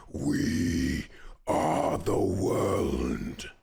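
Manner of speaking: very slow, deep whispered menace; deep whispering god. Acted. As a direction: whisper like an ancient chaos god. GM voices the boss.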